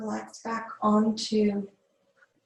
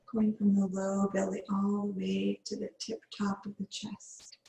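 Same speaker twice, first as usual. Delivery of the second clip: guided meditation. Acted. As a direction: ease the class into calm breathing. Yoga teacher leads breathwork.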